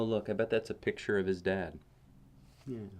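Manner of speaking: ordinary delivery, nothing marked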